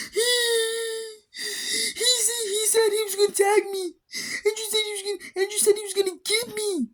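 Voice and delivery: high-pitched imitation of a sobbing child; hammed-up crying, words squeezed out high; mock bawling. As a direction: wail it like a kid tattling in tears. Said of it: "He is bawling his eyes out".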